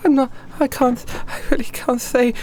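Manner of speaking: high, faltering refusal; timid and halting, afraid to tell; nervous waitress. Acted. Waitress questioned about her chain, evading nervously. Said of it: "She's looking around nervously".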